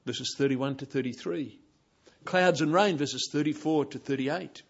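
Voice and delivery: ordinary talk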